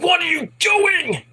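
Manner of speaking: high, drawn-out frantic shout; slow, strained scream of disbelief; berserk mock yell. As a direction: imitate someone completely losing it. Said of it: "Jay's going completely berserk".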